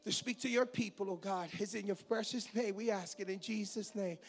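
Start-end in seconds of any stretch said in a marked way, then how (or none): none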